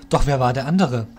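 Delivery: ordinary delivery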